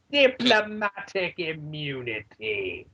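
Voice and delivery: slow, lightly done South African accent. Shown as a demonstration. Host admits to a weak South African accent, slow. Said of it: "That's the extent of my South African accent".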